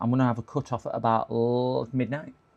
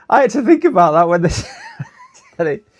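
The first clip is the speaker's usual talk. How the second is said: laughing; 12 semitones higher, 9 dB louder than usual. Real, not acted. Laughing over quiz answer.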